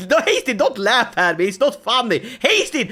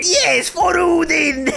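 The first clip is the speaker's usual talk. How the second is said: slow, drawn-out triumphant shout, higher; mock battle cry; 7 semitones higher, slower than usual. Viking killer shouting over the body, triumphant slow battle cry. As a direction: roar it like a gleeful Viking. Voicing himself as gleeful killer.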